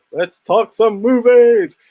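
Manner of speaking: slow, loud host catchphrase; show catchphrase. Retold or imitated. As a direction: announce it like a host. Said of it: "you used to say".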